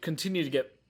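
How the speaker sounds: words pushed out through a burp. Real, not acted. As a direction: burp mid-phrase while talking. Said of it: "I'm sorry, I'm burping a lot".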